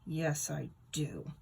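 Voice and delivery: low, slow, soft growl; a low grumpy growl. A narrator's reading. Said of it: "I growl".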